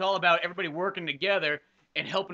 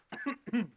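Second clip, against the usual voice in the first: second hard cough; choking cough; 6 semitones higher than usual. Real, not acted. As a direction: cough to clear the throat. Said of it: "choking on water".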